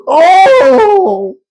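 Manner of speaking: very high, loud mock wail; imitated pained cry. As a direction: yelp like a patient getting cracked. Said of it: "she just goes".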